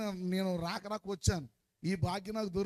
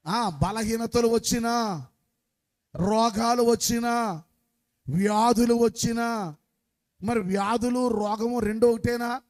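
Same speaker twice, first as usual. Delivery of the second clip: loud preaching call; 4 semitones higher, 9 dB louder, slower than usual. Acted. Leading the congregation's repetition.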